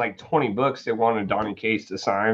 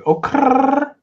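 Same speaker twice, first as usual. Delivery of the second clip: [name]; 4 dB louder than usual. Acted. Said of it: "that was my [name] impression".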